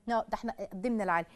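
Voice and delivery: soft, fast proud answer; proud reply. Pretend. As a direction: rattle it off proudly under the breath. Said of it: "قدمتوا إيه للإسلام؟".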